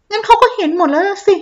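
lifts up in startled dismay; surprised exclaim. A narrator's reading. Heroine realizing he saw everything, startled exclaim. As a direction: blurt it out, caught off guard. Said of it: "เธออุทาน".

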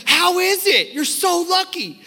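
put-on high, excited kid voices gushing; envious friends. Jealous friends gushing to the boy about his presents, excited and high.

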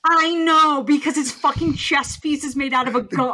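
loud, high-pitched exasperation; worked-up, shouted complaint; exasperated outburst. Real, not acted. Exasperated outburst about expected movie scene.

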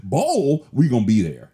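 loud, confident mock coach vow; Jim Mora Junior. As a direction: shout it like a proud coach. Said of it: "Jim Mora Junior said".

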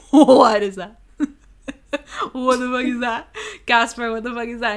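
high, loud cry at a strange sight; comic bellow of alarm; startled shout. Acted. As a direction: shout it out, half laughing. Wise men react to the star.

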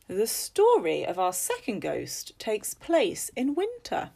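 high, emphatic, lilting storyteller; animated ghost-story telling with big pitch swoops; storyteller Missus Fox. Acted. Missus Fox telling guests about a ghost, animated storyteller.

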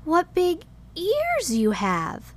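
put-on high, slow, soft wondering girl; little girl voice. A narrator's reading. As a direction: marvel slowly like a young girl.

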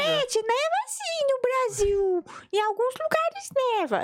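high, slow mock chat voice; reading chat message. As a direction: read the viewer's comment in a put-on high voice. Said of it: "tem gente que me disse, aqui no chat". (lendo o chat com voz aguda e lenta, de brincadeira)

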